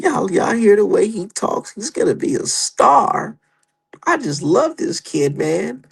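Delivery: high, slow, dreamy fan parody; mock fan gushing. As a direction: swoon over the kid, mocking. Mocking starstruck fans again.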